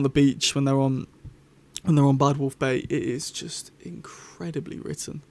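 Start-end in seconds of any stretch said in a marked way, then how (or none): 2.8-5.2 awed. Real, not acted. Awed praise of the beach scene.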